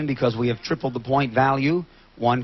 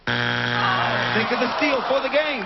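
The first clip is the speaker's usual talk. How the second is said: drawn-out excited call; 7 semitones higher, slower than usual. Real, not acted. Host calls out to team.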